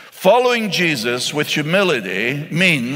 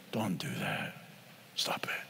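softer, a whispered stop it; hushed rebuke; 14 semitones lower, 19 dB quieter than usual. Acted. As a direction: drop to a near-whisper, firm. Pastor rebuking the judgmental listener.

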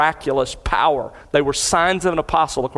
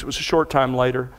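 ordinary delivery

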